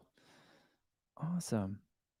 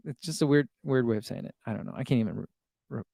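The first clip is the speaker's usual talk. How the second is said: ordinary delivery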